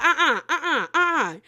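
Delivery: emphatic acted-out denial; mock refusal. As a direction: imitate friends shutting down an excuse. Group rejects her excuse.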